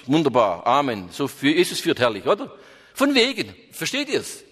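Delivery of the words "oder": normal talking voice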